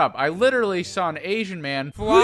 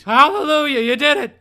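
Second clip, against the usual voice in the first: joyful relief; 6 semitones higher, 8 dB louder than usual. Real, not acted. Finally getting the chairs.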